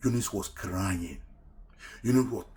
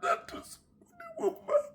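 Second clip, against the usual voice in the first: a real, raw cry of grief; 7 dB louder, slower than usual. Real, not acted. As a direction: voice breaking into a high wailing cry. Breaks down recalling betrayal.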